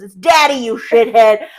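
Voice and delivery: loud barked correction; angry snarl. Retold or imitated. Frank snapping at Dorothy, a loud mock bark. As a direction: bark it at her. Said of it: "he goes".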